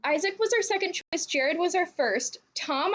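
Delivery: ordinary talk, nothing marked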